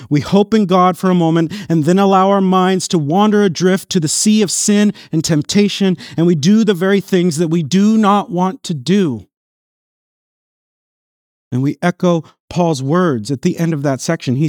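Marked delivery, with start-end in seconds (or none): 0.1-9.3 raised, impassioned preaching; preacher pleading over sin, full force. Acted. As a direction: drive the words home to the congregation.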